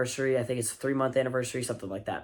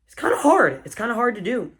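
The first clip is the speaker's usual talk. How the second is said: mock strained cry; 8 semitones higher, 4 dB louder than usual.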